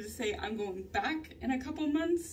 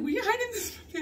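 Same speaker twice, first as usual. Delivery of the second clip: uncle's question; 12 semitones higher, 4 dB louder, much faster than usual. Retold or imitated. Uncle quoted on discovering vaping.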